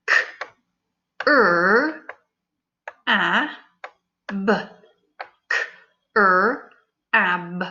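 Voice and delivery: each sound said separately; sounding out letters. Shown as a demonstration. Teacher segmenting crab for pupils. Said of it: "Stretch that word".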